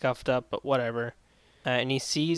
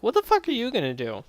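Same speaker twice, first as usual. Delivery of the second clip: much higher, fast sneering taunt; mocking taunt; 10 semitones higher, 3 dB louder, faster than usual. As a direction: taunt him like a bully. Bullies taunting the nerd.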